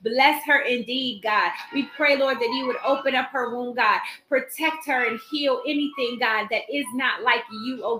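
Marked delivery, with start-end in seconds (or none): none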